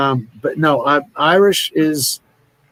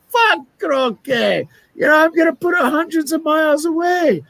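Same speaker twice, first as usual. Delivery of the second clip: put-on drunk Scotsman; 12 semitones higher than usual. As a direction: rant like a sozzled Scot. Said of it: "a couple of Scotsmen. They're drunk. They go".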